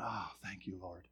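soft, low sigh of thanks; hushed, relieved thanks; quiet relief. Retold or imitated. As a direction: breathe out a grateful little prayer. Retold relieved prayer after apology.

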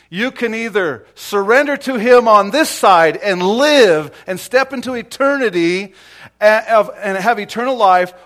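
loud, climbing sermon cry; preaching at full force. Acted. Preacher urging the church at the top of his voice. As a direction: build the point up to a shout.

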